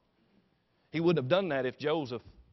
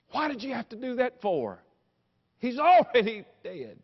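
high anguished cry, swelling louder; tearful protest; 9 semitones higher, 5 dB louder than usual. Acted. Mary watches soldier spear her dead son, anguished cry. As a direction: wail it, dragging out the last words. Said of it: "Mary began to cry".

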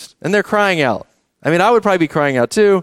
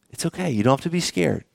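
put-on soothing a child; 8 dB quieter than usual. Parent to a scared child, soft and soothing. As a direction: hush a frightened child gently.

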